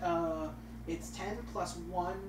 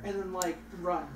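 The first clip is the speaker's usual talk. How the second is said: ordinary delivery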